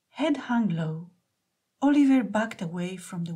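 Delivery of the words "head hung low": ordinary delivery, nothing marked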